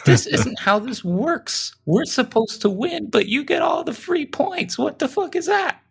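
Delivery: very high, squeaky comic tantrum; mock outraged whine. Rival driver cheated of the win by free points, high mock outrage.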